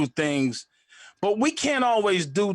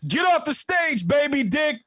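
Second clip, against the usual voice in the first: heckling shout; 10 semitones higher than usual. Retold or imitated. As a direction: shout him off the stage. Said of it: "comes out of nowhere and goes".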